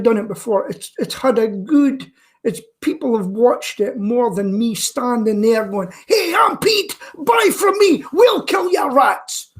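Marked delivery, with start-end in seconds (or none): 6.1-9.5 high-pitched mock advertising patter; hammed-up sales pitch. Man mocking his own video sales pitch, hammed-up high pitch.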